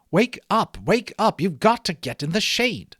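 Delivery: high, loud urgent wake-up call. A narrator's reading. Stranger shaking the professor awake, calling loudly. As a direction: rouse him, he's in the sun. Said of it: "heard this same voice which kept saying".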